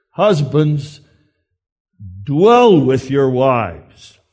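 "dwell" drawn out slowly, set off by a pause; emphasized first words. Shown as a demonstration. Preacher quoting the text's first words to husbands, emphatic. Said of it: "if we emphasize only those first words".

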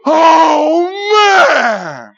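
high, very loud drawn-out yell; scream. Acted. Rider plunging down the steep hill, screaming. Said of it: "if I scream, I feel better".

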